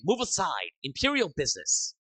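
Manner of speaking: shouted command. Acted. Imperial troopers clearing crowd.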